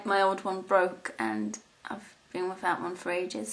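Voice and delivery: normal talking voice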